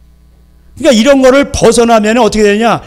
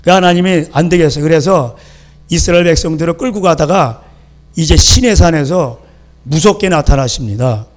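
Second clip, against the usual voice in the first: ordinary delivery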